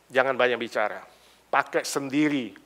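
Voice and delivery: ordinary delivery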